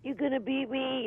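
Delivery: scared plea. Retold or imitated. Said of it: "And she's going".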